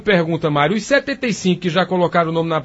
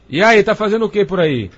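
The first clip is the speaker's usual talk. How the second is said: ordinary talk, nothing marked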